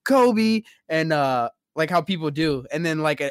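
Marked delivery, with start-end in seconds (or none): 0.0-0.6 Kobe shout. Retold or imitated. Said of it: "and he was like".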